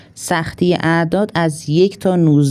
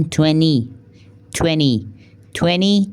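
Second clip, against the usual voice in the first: American "twenty" with the second t dropped, "twenny"; much slower than usual. Shown as a demonstration. Teacher showing the American "twenty" to students.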